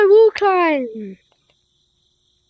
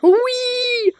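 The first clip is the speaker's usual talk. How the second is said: high gleeful squeal; gleeful whee; 7 semitones higher than usual. Real, not acted. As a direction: squeal with joy like on a ride. Gleeful squeal while flying.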